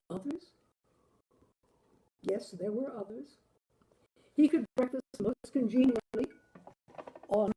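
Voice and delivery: ordinary delivery, nothing marked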